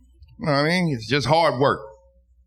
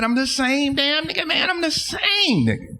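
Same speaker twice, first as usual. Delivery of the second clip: pitched way up, insisting he hasn't changed; high pleading protest; 13 semitones higher than usual. Retold or imitated. To the suspicious weed man, a high desperate protest. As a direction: squeak out a desperate denial. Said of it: "I said".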